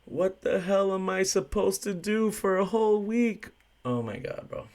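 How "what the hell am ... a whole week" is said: high, slow, whining disbelief; pitched-up pleading whine, dragged out; whiny drunk regular. Retold or imitated. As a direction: play the banned drunk begging in a high whine. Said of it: "he's like this".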